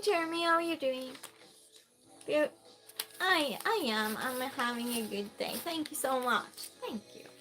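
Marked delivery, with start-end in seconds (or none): none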